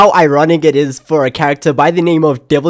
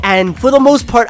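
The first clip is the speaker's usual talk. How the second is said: ordinary talk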